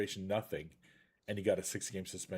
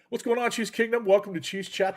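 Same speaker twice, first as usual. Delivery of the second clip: high, loud hype intro; show host welcome; 10 semitones higher, 8 dB louder than usual. Acted. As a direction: greet the fans like a show host. Opening the show to fans.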